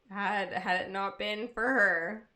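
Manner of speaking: usual speaking voice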